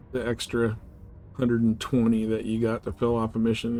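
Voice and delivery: ordinary delivery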